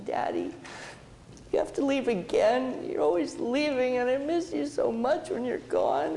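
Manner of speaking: put-on high, pleading little-girl voice; a hint of a tearful child begging daddy to stay; tearful daughter. Daughter pleading as daddy leaves for the airport, high and tearful. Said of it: "And she was in tears".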